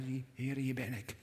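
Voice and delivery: soft, low and humble, as if praying; hushed prayer. Acted. Preacher voicing the prayer for his listeners, hushed and humble. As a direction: offer yourself quietly to God.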